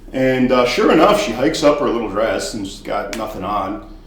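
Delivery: ordinary delivery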